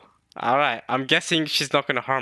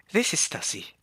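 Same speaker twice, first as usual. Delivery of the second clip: a lightly put-on, softer Stussy voice; imagined Stussy voice; 3 semitones higher, 4 dB quieter than usual. Shown as a demonstration. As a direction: say it the way you picture Stussy sounding. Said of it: "this is how I imagine her voice".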